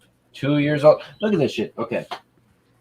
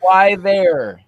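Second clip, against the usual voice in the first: hammed-up disbelief, pitched up; mock exasperation; 7 semitones higher, 5 dB louder than usual. Asking the dog why it chose the roller, mock exasperated. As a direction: play it as fed up with the dog. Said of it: "But I'm like".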